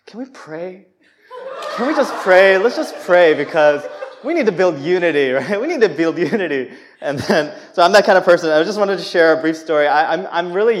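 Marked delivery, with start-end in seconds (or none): 0.0-0.8 soft pious request. Retold or imitated. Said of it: "I was like". 1.8-6.7 loud, laughing self-mocking plea; mock your own pious self while laughing; laughing at his old pious self; laughing mock piety. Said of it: "I was like".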